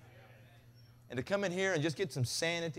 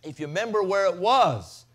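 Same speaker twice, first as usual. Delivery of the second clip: loud, high preaching that keeps climbing; full-force preaching; 6 semitones higher, 9 dB louder than usual. Acted. Preacher to congregation, loud fervent preaching. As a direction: preach it out to the whole room.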